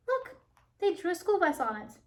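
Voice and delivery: high and quick with delight; excited. Acted. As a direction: point it out thrilled. Excited character voice.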